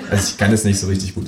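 usual speaking voice

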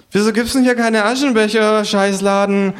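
hohe, laute Figurenstimme, schimpfend; hoch und laut losschimpfen; fluchendes Känguru. Acted. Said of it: "und flucht". (hohes Känguru-Geschimpfe)

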